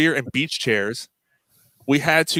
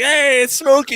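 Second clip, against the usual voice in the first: higher, hammed-up voice of a friendly stranger; imitating a street dude; 11 semitones higher, 4 dB louder, slower than usual. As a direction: play the chummy guy at the beach, lightly. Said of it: "So he's like".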